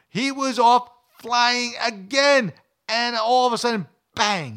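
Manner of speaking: big dramatic storyteller's surge; excited climax. Retold or imitated. Climax, swan attacks a second time.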